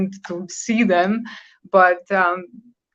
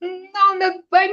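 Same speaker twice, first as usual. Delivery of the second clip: high, quick surprised protest; 12 semitones higher than usual. Real, not acted. Guest protests the cowboys guess, surprised. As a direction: jump up in pitch to deny it.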